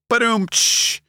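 ba-doom then a cymbal shh; mock rimshot after a joke; vocal rimshot. Shown as a demonstration. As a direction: mouth a drum rimshot and cymbal. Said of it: "pick up some sticks and go".

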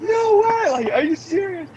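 hammed-up happy-tears shriek; retold happy crying. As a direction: squeal it, overjoyed and incredulous. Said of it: "crying, like, happy".

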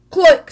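sharp loud click; the camera snapping a picture. A narrator's reading. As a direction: snap it like a shutter. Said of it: "said the camera".

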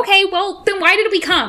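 high, loud exasperated protest; mock exasperation. Rescuer challenging Godric's calm, mock exasperation. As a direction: demand an answer, hammed-up.

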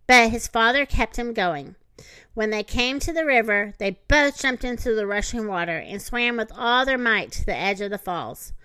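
ordinary delivery

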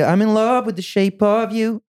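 sung slowly and high in an American accent. Shown as a demonstration. Singer showing how his song would sound, American accent. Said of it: "if I had an American accent".

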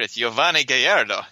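Yovani said the Spanish way; Spanish accent. Shown as a demonstration. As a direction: Gallardo with a Spanish double L. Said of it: "Where's your Spanish accent".

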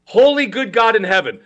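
usual speaking voice

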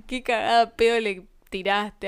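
a hint of an amazed listener, drawn out; imitating Javi's reaction. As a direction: act out a friend saying you really told them off. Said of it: "y te dijo como".

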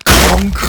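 voiced sound effect, high and loud; loud clunk. A narrator's reading. Narrator imitating the lock breaking, loud clunk. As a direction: punch it out like breaking bone.

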